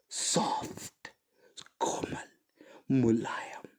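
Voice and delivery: whispered softly, word by word. Shown as a demonstration. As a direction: breathe the words out gently to show a soft tone. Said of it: "एक प्यारी सी आवाज".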